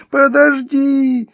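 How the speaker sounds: very high, loud, stretched squeal; shrieked. Acted. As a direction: squeal it out in panic at the last count. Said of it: "взвизгнула на последнем счёте Вероника".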